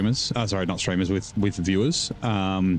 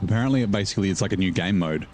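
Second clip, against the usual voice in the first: ordinary talk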